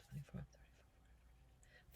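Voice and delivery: counting under her breath; whispering. Real, not acted. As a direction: whisper the numbers to herself.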